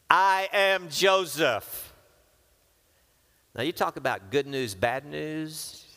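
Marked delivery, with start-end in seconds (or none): 0.1-1.9 slow, loud and high, each word landing; dramatic reveal. Acted. Joseph revealing himself to his brothers, slow dramatic proclamation. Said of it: "he said to them those words".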